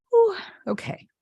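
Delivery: a high, breaking whew as she starts to cry; real tears. Real, not acted. Moved to tears on a live stream, a tearful whew. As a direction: let the tears catch the breath, then steady yourself. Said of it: "Now I have tears running down my face".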